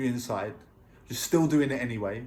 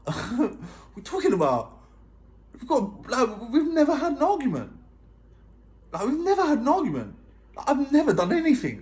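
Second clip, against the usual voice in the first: high, loud disbelief with a laugh in it; incredulous protest; 11 semitones higher, 4 dB louder than usual. Retold or imitated. Boyfriend baffled by being dumped, incredulous protest. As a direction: protest your innocence, voice shooting up. Protesting innocence to his partner.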